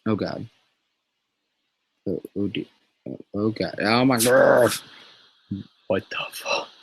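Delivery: dismayed outburst. Real, not acted. Drawing and watching the blade go wrong, loud dismay. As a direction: react as the drawing goes wrong.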